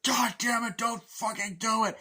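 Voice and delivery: high, loud anguished shout; yelling don't do it; desperate yell. Retold or imitated. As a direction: scream at him to stop. Pleading yell in standoff.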